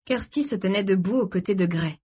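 ordinary delivery, nothing marked

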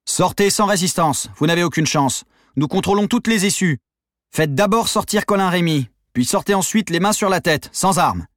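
high, sustained police command; megaphone shout. A narrator's reading. Police to besieged gang, megaphone shout. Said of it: "La voix dans le mégaphone perça".

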